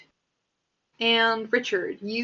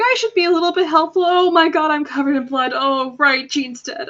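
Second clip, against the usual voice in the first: panicked; 4 semitones higher, 6 dB louder than usual. Retold or imitated. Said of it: "'cause you're like".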